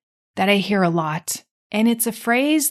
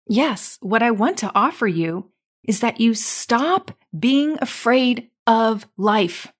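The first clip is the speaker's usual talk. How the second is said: usual speaking voice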